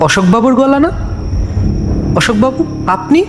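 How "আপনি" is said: ordinary delivery, nothing marked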